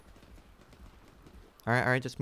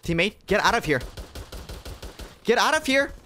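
yelling at a teammate, rising higher; cover me shout; 7 semitones higher, 4 dB louder than usual. Real, not acted. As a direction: shout it louder the second time.

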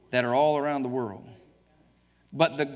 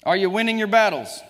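loud rousing question to the room; calling out; 5 semitones higher, 8 dB louder, faster than usual. Real, not acted. Preacher rousing the congregation with a loud call.

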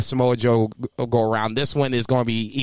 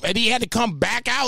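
ordinary delivery

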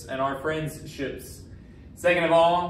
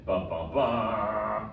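slow, drawn-out dun-dun-dun; mock dramatic sting; 5 semitones lower, 3 dB quieter, much slower than usual. Teacher capping how friends jumped in, mock dramatic sting. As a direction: sound an ominous sting, stretching the last note.

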